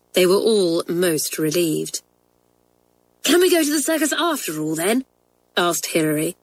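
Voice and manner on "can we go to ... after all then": quick high hopeful ask; eager hope. Acted. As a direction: rush it out, hopeful. Hopeful girl asks eagerly.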